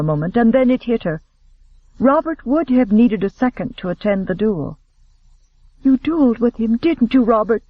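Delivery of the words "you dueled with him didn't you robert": alarmed guess. A narrator's reading. Sister guesses the duel, alarmed.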